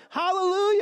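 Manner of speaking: high, loud hallelujah proclaimed like a heavenly crowd; ringing hallelujah. Acted. Preacher echoing the crowd's cry, loud and high. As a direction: lift it up like a roaring multitude.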